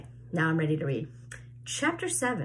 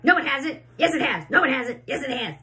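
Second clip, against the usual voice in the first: bickering; 6 semitones higher, 4 dB louder, faster than usual. A narrator's reading. Aunts bickering back and forth.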